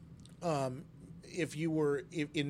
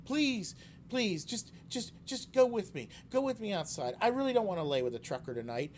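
pleading woman; 8 semitones higher than usual. Retold or imitated. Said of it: "she grabs a hold of me and says".

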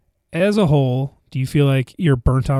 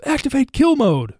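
Spider-Man voice; 11 semitones higher, 4 dB louder than usual. Retold or imitated. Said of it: "he goes".

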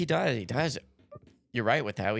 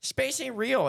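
high, emphatic mock claim; mock dramatic; 14 semitones higher, slower than usual. Real, not acted. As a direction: state it like a wild secret. Repeats conspiracy claim.

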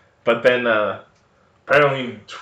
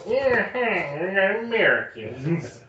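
much higher, slow mock American twang; mock American accent; 8 semitones higher, much slower than usual. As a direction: play how foreigners hear Americans. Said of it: "that's how everyone hears us".

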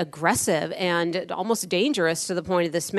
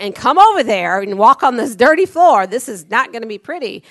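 higher and much louder, a threatening scold; warning the salesman at full volume; loud stern warning; 4 semitones higher, 10 dB louder than usual. Retold or imitated. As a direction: shout down the salesman from the pedicure chair.

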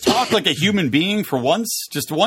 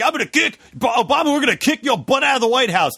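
high-pitched pro-wrestler bluster; blustery Chris Christie; 9 semitones higher than usual. Retold or imitated. Christie threatening Obama, blustery mock wrestler voice. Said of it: "doing, like, a blustery impression".

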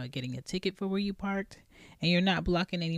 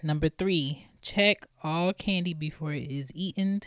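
ordinary talk

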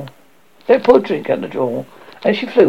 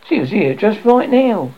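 higher, mildly impatient reply; a little impatient; 7 semitones higher, 4 dB louder than usual. A narrator's reading. Peter insisting the fairy was here, mildly impatient. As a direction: answer with a touch of impatience. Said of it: "he said a little impatiently".

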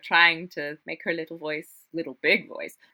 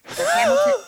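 high, hammed-up showtune voice; mock grand musical voice; mock Hamilton; 7 dB louder than usual. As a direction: puff it up like a big Broadway star.

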